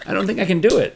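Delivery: ordinary delivery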